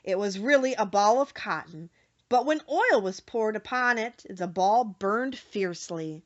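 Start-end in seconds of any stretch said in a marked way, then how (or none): none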